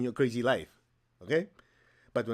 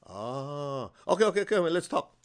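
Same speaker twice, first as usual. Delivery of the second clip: master relents; 3 semitones higher than usual. Retold or imitated. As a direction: gladly give in, okay okay. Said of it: "He goes".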